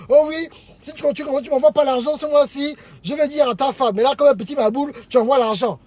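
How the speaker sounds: loud acted telling-off, piled up without a break; mocking scolding rant. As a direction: imitate threats thrown at a married man. Mistresses threatening a married man, mocked.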